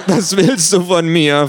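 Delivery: gequält. A narrator's reading. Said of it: "frage ich gequält".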